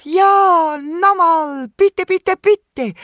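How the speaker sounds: very high, loud pleading; squeaky high little kangaroo begging. A narrator's reading. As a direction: beg like an excited small child. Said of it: "bettelt das kleine Känguru".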